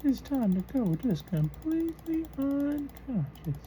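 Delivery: put-on high old man voice. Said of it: "Me getting down here in this voice".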